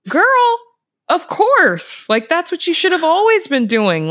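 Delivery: high, loud imitation of a delighted mom; emphatic approval. Mom backing her child's career switch, emphatic and high. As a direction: play the mother cheering the choice on. Supportive mom's reaction acted out.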